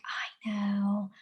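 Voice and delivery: soft unvoiced whisper; empathetic whisper. Shown as a demonstration. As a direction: whisper it gently to comfort the child. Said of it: "I'm really empathetic".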